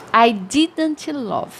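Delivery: I didn't love said very slowly and clearly; slow model phrase. Shown as a demonstration. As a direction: spell it out slowly for learners. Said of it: "pra falar assim".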